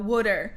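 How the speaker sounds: WATER said as "wooder", loud; Jersey accent. Shown as a demonstration. Jersey native showing her accent, WATER as loud "wooder". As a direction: punch out WATER as East Coast "wooder". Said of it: "now I hear the accent".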